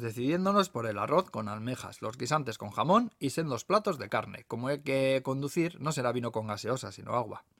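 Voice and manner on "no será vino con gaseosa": plain reading voice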